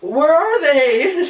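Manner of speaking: very high, slow, drawn-out panicked question; mock freaking out. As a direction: imitate a drunk person's rising alarm. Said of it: "I just started freaking out".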